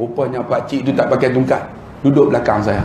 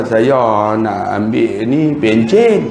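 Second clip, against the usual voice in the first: put-on lower, slow frail old man; 6 semitones lower, much slower than usual. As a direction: speak slow and low like a tired pensioner. Pakcik tua minta pencen. (pakcik tua minta pencen dengan suara perlahan)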